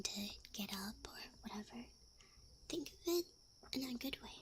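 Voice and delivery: soft, breathy half-whispered encouragement; reassuring ASMR. Real, not acted. Streamer reassuring listeners about Monday, soft ASMR half-whisper. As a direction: gently soothe in a half-whisper.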